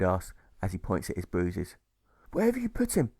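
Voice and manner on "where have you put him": accusing. Acted. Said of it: "Cleo accuses".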